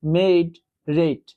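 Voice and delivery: মেড, রেট read very slowly and deliberately; slow example words. Shown as a demonstration. Teacher reading example words to students, very slowly. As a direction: spell out each example word slowly. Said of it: "এই যে দেখুন".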